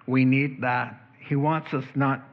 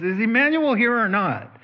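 impatient call; 8 semitones higher, 4 dB louder than usual. Retold or imitated. Nurse impatiently calling patient again.

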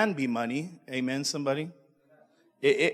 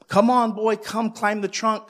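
higher and louder, bursting with joy; excited joy; 7 semitones higher, 6 dB louder than usual. Acted. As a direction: call out to the boy, thrilled to see him. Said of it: "excited, shook with joy".

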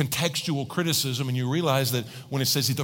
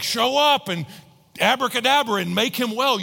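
higher, insistent plea; acted demand; 6 semitones higher than usual. Grieving family wanting Jesus to heal, insistent. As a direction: urge it as an impatient appeal.

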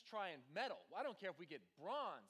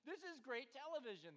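mock gleeful announcer; 6 semitones higher than usual.

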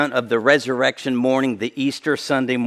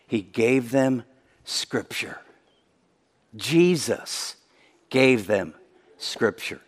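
hushed emphasis; 6 semitones lower, 8 dB quieter, slower than usual. Real, not acted. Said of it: "repeated quiet emphasis".

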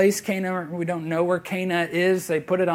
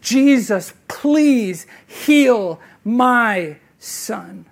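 loud, slow, begging cry of a father; a desperate father's loud, drawn-out plea; desperate pleading; 4 semitones higher, 7 dB louder, much slower than usual. Retold or imitated. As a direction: plead as if his son is dying. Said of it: "He is pleading with Christ for this".

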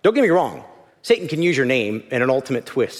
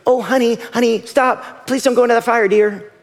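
higher, sugary parent coaxing; hammed-up polite parental plea; mock gentle pleading; 9 semitones higher, 4 dB louder than usual. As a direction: coax a child far too sweetly. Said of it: "do you look at them and say".

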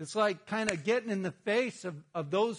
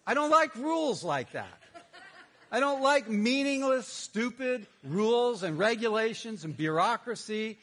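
louder and higher, fired up; heated rant; 5 semitones higher, 5 dB louder than usual. Real, not acted. Pastor rants to the congregation about pointless rules. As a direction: rail against red tape. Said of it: "ranting against rules".

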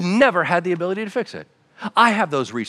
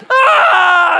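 high, loud mock scream; falling scream; 10 dB louder than usual. Child going over the waterfall, a high loud mock scream. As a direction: yelp like you're plunging over the falls.

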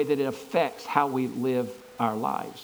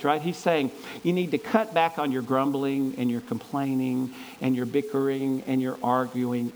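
normal talking voice

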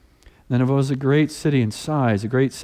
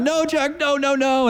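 put-on protesting boy; 13 semitones higher than usual. Brother blocking toddler.

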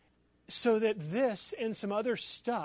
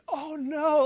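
high, loud retold "oh no" of dismay; dismayed outcry; 10 semitones higher, 8 dB louder than usual. Man telling how he lost hours of work, dismayed. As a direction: cry out as if it just vanished.

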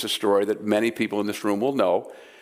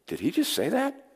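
higher, softer stunned aside between disciples; imitating stunned disciples; 6 semitones higher, 5 dB quieter than usual. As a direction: disciples look at each other, shocked. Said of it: "his disciples looking at each other going".

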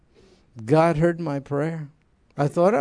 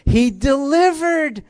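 high and louder, slowing on delivered; preacher calls out the answer to the room, high and louder; raised voice; 9 semitones higher, 4 dB louder, slower than usual. Real, not acted.

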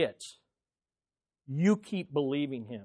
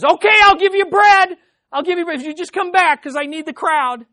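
panicked, much higher and louder pleading; freaked-out Jesus; 13 semitones higher, 15 dB louder than usual. Retold or imitated. Preacher mocking a panicked Jesus, high frantic plea. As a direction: beg them back in a frantic high voice. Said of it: "He freaks out and says".

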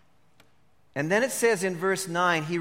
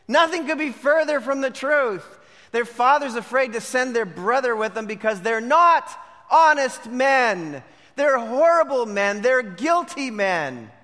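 raised, punchy pulpit delivery; forceful preaching; 6 semitones higher, 6 dB louder than usual. Real, not acted. Preacher denouncing the brothers' claim to honesty, forceful preaching. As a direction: hammer each charge home.

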